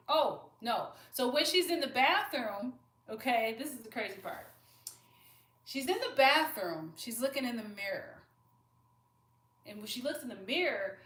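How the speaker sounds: loud, thrilled build-up; excited storytelling. Real, not acted. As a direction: tell it like the big reveal. Building to story's crazy part.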